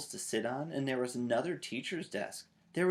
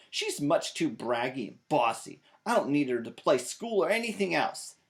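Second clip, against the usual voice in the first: huffy rant; 6 semitones higher, 7 dB louder than usual. A narrator's reading. Sulking child rants to Mama.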